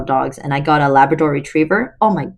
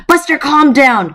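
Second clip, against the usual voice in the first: ordering the dog; 9 semitones higher, 4 dB louder than usual. Acted. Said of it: "Connor ordered".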